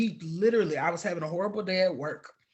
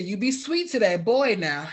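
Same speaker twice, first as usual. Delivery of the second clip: louder, higher, playful mock warning; imitated fond admonishment; 4 semitones higher, 7 dB louder than usual. Couple reminding her at goodbye, imitated fond admonishing. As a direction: repeat her warning loudly and affectionately. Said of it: "they said it again".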